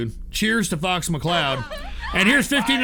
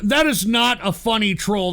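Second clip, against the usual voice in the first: ordinary talk, nothing marked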